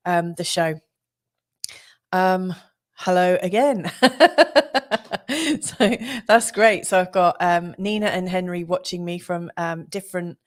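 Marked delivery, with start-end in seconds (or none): 4.0-5.6 bright laugh. Real, not acted. Laughing after greeting again.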